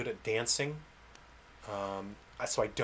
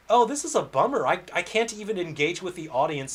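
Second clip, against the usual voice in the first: louder, higher, fed-up venting; exasperated outburst; 6 semitones higher, 6 dB louder than usual. Retold or imitated. Game dev venting to his audience, exasperated and loud. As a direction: throw up your hands at the unfairness. Frustrated inner monologue of developer.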